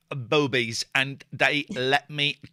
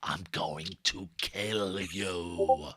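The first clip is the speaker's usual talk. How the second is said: slow, low, soft menacing voice; creepy low voice; 10 semitones lower, 7 dB quieter, much slower than usual. Pretend. Host voices creepy mouthed line.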